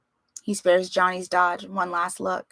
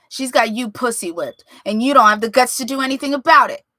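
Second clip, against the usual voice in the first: furious, fast accusing shout; shouting at father; angry yell; 4 semitones higher, 9 dB louder than usual. Acted. Son yelling at his father, angry and fast.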